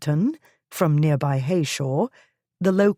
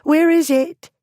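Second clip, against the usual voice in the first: urgent, high; 13 semitones higher, 6 dB louder than usual. Acted. Polly urgently asks for privy.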